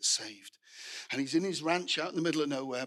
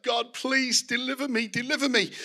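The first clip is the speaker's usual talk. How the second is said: higher-pitched acted plea to God; pleading cry out; 6 semitones higher than usual. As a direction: plead for rescue without shouting. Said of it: "he cries out".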